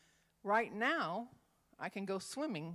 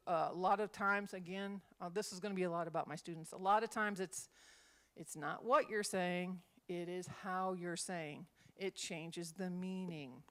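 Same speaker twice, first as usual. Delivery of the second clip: ordinary talk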